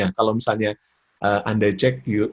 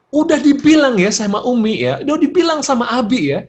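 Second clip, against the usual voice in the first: loud, high acted parental scolding; angry raised intonation; 12 semitones higher, 8 dB louder than usual. Parents telling off a disobedient child, acted angry raised voice. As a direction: nag the child at full raised pitch. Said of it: "Itu naik intonasinya".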